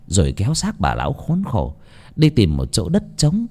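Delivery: ordinary delivery, nothing marked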